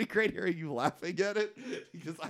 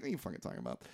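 muttered retort; 10 semitones lower, 14 dB quieter, much faster than usual. Retold or imitated. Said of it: "I'm like".

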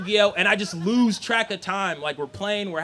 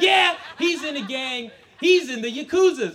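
loud, high insistence; emphatic accusation; 5 semitones higher, 6 dB louder than usual. Acted. Dad accusing his son to mom, emphatic accusation. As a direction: hammer it home to the room.

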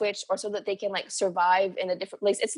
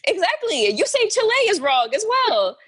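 high, loud exclamation; emphatic agreement; 12 semitones higher, 7 dB louder than usual. Real, not acted. Agreeing with a friend about mispronounced slang, loud and emphatic. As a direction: exclaim it loudly, pitch way up. Agreeing outsiders say the word wrong.

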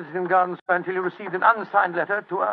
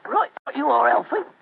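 a halting, slightly off Cockney; weak Cockney accent; 5 semitones higher than usual. Pretend. Watson answers his partner in a so-so Cockney accent. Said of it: "My Cockney accent doesn't compare with yours".

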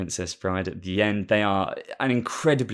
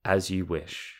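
deadpan film quote; slower than usual. Retold or imitated. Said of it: "Wesley responds".

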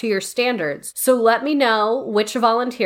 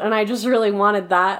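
usual speaking voice

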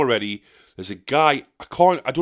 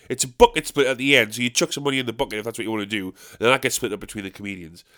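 ordinary talk, nothing marked

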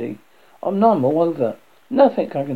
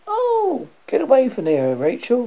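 alarmed; 9 semitones higher, 3 dB louder than usual. Acted. Scolding someone near danger.

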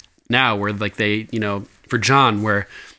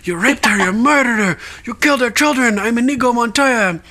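Inigo Montoya; 12 semitones higher than usual. Retold or imitated. Host quoting Oberyn's Princess Bride line, hammed-up avenger. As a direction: ham up the vengeful duelist line. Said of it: "they referenced The Princess Bride".

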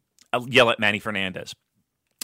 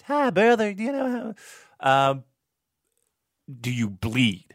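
high squeaky wrestler voice imitating Abdul; Abdul's high-pitched voice; 4 semitones higher, slower than usual. Wrestler Abdul politely asks storyteller if he bleeds, high-pitched. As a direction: friendly ask from a giant in a high voice. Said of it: "you know how he talks in that high-pitched".

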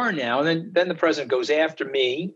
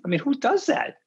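incredulous; 12 semitones higher than usual. Real, not acted. Outraged about attack on wife.

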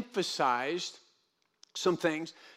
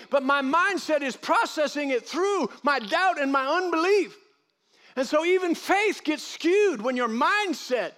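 higher, louder preacher's build; preaching at full force; 8 semitones higher, 3 dB louder than usual. Acted. Preacher rousing the congregation about doubt, full force. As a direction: lift the congregation with rising fire.

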